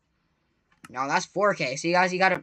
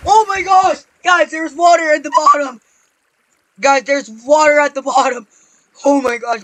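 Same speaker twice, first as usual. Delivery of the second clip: loud, raised-pitch alarm; panicked yelling; 5 semitones higher, 9 dB louder than usual. Real, not acted. Gamer spots water below after near fall, panicked yelling. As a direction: yell a warning in a panic. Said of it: "I'm sorry for yelling".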